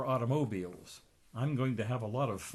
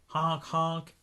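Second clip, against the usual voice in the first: voiced car horn, a bit higher; honk honk; 4 semitones higher than usual. A narrator's reading. Reader honks for the car.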